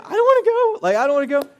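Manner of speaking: very high, loud mock child voice; frightened little kid. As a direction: squeal it like a panicked child. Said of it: "your little kid".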